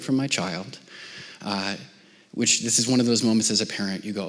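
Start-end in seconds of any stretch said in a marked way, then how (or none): none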